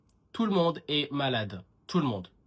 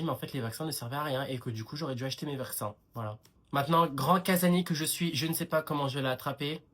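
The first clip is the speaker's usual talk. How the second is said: ordinary talk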